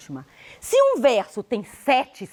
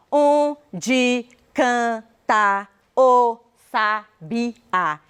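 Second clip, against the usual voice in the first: every syllable separate and drawn out, very slow; much slower than usual. Shown as a demonstration. Teacher showing how one does not speak, syllable by syllable. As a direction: chop the verse into isolated syllables. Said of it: "E eu não falo".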